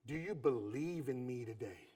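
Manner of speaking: quiet, low asking; a hushed husband's plea to his wife; soft low plea. Retold or imitated. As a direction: ask it softly and low. Husband's question, first take.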